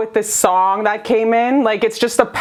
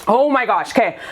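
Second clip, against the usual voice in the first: ordinary talk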